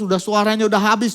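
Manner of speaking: ordinary talk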